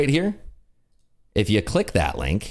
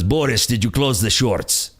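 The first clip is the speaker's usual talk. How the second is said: slow, hammed-up Russian accent; cartoon Russian accent, drawn out; bad Putin accent. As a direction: play a Russian boss questioning his underling. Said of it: "the worst Putin accent ever".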